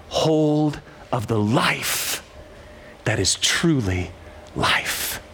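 slowing to a near hush at the end; slow hushed reading. Acted. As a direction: let each word land, drawing them in. Reads verse slowly, hushed.

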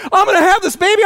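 high, loud retold warning; urgent announcement. Wife telling husband the baby's coming, high urgent. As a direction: insist it's happening now. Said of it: "She goes".

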